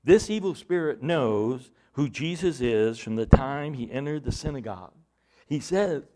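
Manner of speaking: ordinary talk, nothing marked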